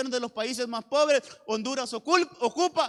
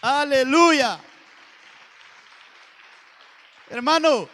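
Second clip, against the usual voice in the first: loud, high preacher's exclamation; ringing, raised praise; exultant praise shout; 4 semitones higher, 13 dB louder, much slower than usual. Real, not acted. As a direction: call it out high to the whole church.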